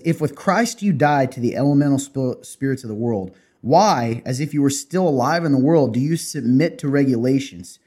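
loud, emphatic Bible reading; scripture reading. Real, not acted. Podcast host reads Colossians to his co-host, emphatic scripture reading.

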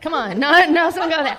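mock protest with a laugh. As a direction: wave it off through laughter.

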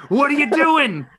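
high, loud mock confrontation; mock outraged yell. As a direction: snap at her like you're ready to swing. Imagined fight with old lady.

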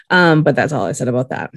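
normal talking voice

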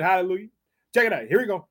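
ordinary delivery

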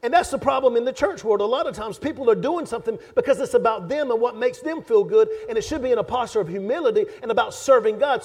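loud, high, driving sermon voice; fiery preaching. Acted. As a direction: press the point home to the congregation. Preaching at full force.

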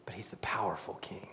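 soft awed whisper; hushed whisper. Real, not acted. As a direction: breathe "powerful" like a secret. Whispered then repeated aloud.

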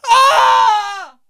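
very high, loud drawn-out scream; waiter's scream of horror; horrified scream. Acted. Server hears dreaded IPA question.

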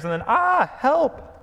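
sudden high loud yelp for help; startled cry. Acted. Bree startled by Aslan's whisker, crying help. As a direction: shriek as the whisker touches. Lion tickles his ear.

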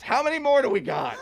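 high-pitched mock wail of impatience; hammed-up exasperated whine; exasperated wife. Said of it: "she goes".

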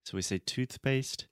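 "toothpaste" with tongue out then quick p; tongue sticking out. Shown as a demonstration. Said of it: "my tongue is out of my mouth".

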